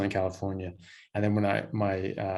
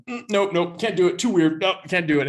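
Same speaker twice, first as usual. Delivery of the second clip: high, loud mock disgusted refusal; Ramsay refusing; 9 semitones higher, 7 dB louder than usual. Ramsay bolting from the breast milk cappuccino, high and loud. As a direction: back away squeaking no. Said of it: "And Ramsay's like".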